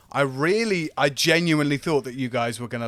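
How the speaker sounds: ordinary talk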